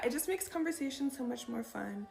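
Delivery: normal talking voice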